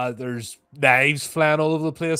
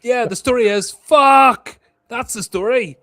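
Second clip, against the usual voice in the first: high, loud cry bursting on the swear word; exasperated outburst; 12 semitones higher, 9 dB louder, slower than usual. Acted. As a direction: blurt it out at the end of your tether. Panicked player's character snapping at question.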